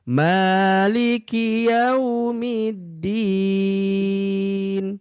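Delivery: very slow Maliki yaumiddin, final vowel held four counts; four-beat madd. Shown as a demonstration. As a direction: stretch the mad to four harakat. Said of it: "empat harokat saja". (mad empat harakat)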